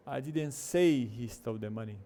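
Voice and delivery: slow, "say" punched and stretched; stress on SAY. Shown as a demonstration. Teacher showing the other form, stress on "say". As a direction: hit the word "say" hard. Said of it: "Versus a outra forma".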